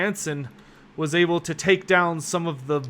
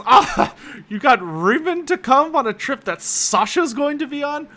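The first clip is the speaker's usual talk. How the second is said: high, giggly talk through laughter; laughing audibly; 10 semitones higher, 4 dB louder than usual. Acted. [name] laughing at Raymond joining Sasha's trip. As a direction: laugh out the words, amused. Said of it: "he laughs audibly. He says".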